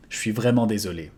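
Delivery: "Je suis vraiment désolé" run together quickly at native speed; natural fast take. Shown as a demonstration. Teacher giving the natural-speed version, fast. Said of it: "I'm truly sorry".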